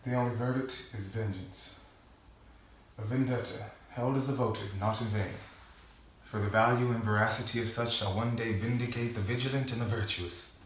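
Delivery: hushed, solemn avenger's vow; theatrical monologue. Acted. Performs film monologue from memory.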